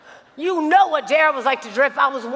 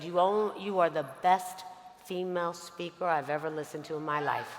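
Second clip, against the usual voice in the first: softer and lower, intimate praise; hushed reassurance; 7 semitones lower, 9 dB quieter than usual. Retold or imitated. Mentor quietly reassuring Lauren before her speech, hushed. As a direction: reassure her in a low hush. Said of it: "He said".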